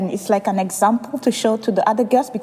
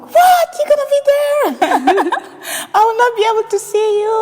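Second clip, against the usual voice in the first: shocked mom; 12 semitones higher than usual. Retold or imitated. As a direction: squeal it like a stunned mom. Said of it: "My mom, she was like".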